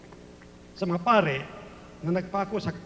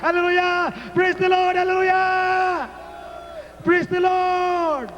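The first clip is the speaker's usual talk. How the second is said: high, long-held praise cries; shouted praise; 8 semitones higher than usual. Real, not acted. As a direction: stretch out each cry for the crowd. Preacher leads shouted praise.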